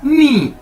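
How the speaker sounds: नी set apart as a clear model word; Chinese nǐ. Shown as a demonstration. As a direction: say नी high, then let it drop. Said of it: "इसकी pronunciation ये आप brackets में".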